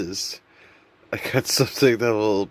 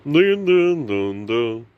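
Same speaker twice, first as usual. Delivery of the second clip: sung startup-jingle notes, first one higher and louder; shutdown noise; 3 semitones higher, 6 dB louder, slower than usual. Retold or imitated. Vlogger imitating his brain's shutdown chime, sung notes. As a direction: hum the Windows shutdown tune. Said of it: "Then I hear".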